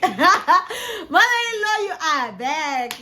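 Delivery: high, slow, laughing welcome; laughing daughter-in-law greets her mother-in-law; laughing greeting. Retold or imitated. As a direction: stretch it out with a laugh of relief.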